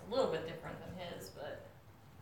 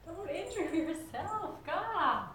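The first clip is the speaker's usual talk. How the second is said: high pet scolding; 8 semitones higher, 4 dB louder, much slower than usual. Real, not acted. Scolding the dog after its jump.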